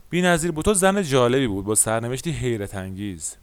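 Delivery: normal talking voice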